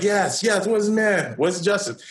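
high, eager voices of the women in church; indignant ladies. Retold or imitated. Preacher voicing the women in church, demanding where the man is, high and indignant. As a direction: play the ladies cheering for justice. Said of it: "all the ladies are like".

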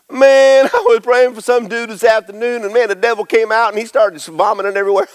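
high, excited retelling of a miracle; excited disciple. Retold or imitated. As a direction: gush like a thrilled disciple. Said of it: "I'm gonna ad lib what I think happened".

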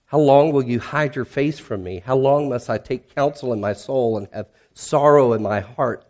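loud, pleading scripture reading with rising cries; impassioned psalm reading. Acted. Pastor reads Psalm 13's lament aloud, loud and pleading. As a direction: cry out the lament to God.

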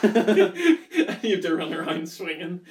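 laughing. Real, not acted. Laughing while describing piñata.

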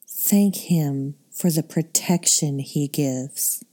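normal talking voice

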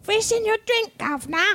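high-pitched trashy British accent. Shown as a demonstration. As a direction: put on a hammed-up, trashy British voice. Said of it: "My British accent sounds like, uh, like, trashy British".

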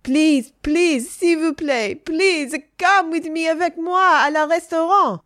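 high, loud, impassioned begging; pleading French suitor. A narrator's reading. Kneeling French teacher begs Adeline to come along, pleading. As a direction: beg desperately in a theatrical voice. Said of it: "went down on two knees and he clasped his hands together".

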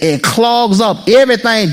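ordinary talk, nothing marked

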